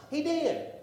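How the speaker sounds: high, punchy answer; triumphant reply. Acted. Triumphant answer in sermon refrain.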